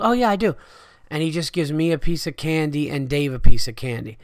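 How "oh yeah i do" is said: high, lighter casual reply; imitating Ari again. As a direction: toss it off offhand. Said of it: "He goes".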